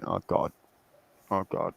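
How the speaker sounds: soft, low aside of dismay; muttered dismay. Retold or imitated. Retelling the prosecutor sitting in court, muttered dismay. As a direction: imitate someone quietly despairing under their breath.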